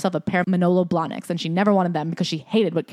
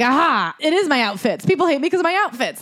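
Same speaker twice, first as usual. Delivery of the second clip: lightly acted, higher-pitched aha moment; mock vindication; 6 semitones higher than usual. Host imitates the writer proving her point, mock vindication. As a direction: seize on the proof, pitch climbing to the end.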